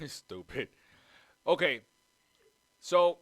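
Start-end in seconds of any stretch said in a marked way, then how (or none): 1.5-3.2 loud, high okay to restart; loud reset. Real, not acted. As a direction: snap everyone back on topic. Calls the show back to order.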